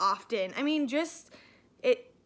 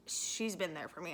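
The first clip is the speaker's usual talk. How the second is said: real tears starting, much softer; choked up; 6 semitones lower, 8 dB quieter than usual. Real, not acted. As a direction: voice dropping low and soft, close to tears. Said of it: "I feel like I'm getting emotional".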